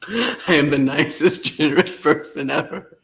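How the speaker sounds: laughing at your own boast. Real, not acted. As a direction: brag about yourself while giggling. Mock brag while laughing.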